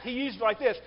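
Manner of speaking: normal talking voice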